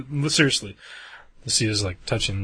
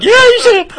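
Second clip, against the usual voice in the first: laughing reply; 13 dB louder than usual. Retold or imitated. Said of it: "And I was like".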